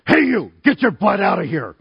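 loud mock tough-kid yell; hammed-up teenage threat to scare an intruder; deepest junior high voice. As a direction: shout like a boy trying to sound big. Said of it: "in my deepest junior high voice".

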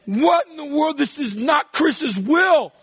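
high, loud, slowed exasperated yell; shocked dad shout. Retold or imitated. Dad yelling at the spilled mess, shocked. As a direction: bellow it in disbelief.

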